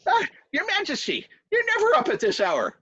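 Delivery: very high, merry greeting to a king; surprised, squeaky-high servant; jovial servant. Acted. Voices the servant Seamus.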